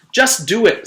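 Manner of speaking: high, loud impatient order; girl urging Paul, high and loud; urgent insistence. Acted. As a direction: snap it. Leslie insists.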